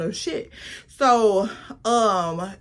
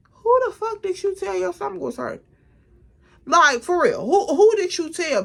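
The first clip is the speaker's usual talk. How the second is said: outraged disbelief; 8 semitones higher than usual. Real, not acted. Telling friends about the roommate's excuse, incredulous and much higher. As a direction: pitch shoots way up in stunned disbelief.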